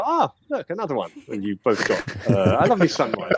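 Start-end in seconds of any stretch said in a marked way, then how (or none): 0.0-1.1 high-pitched "ah", then settling; mock little cry of discovery; acted pleased discovery. Game master voices a player spotting another flower, pleased discovery. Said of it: "You go".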